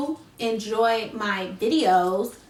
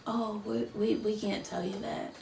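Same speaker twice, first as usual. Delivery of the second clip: much softer, hesitant reply; hushed refusal; 2 semitones lower, 12 dB quieter than usual. Retold or imitated. Office staff declining to share tenant information, hushed. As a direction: imitate a quiet, reluctant clerk.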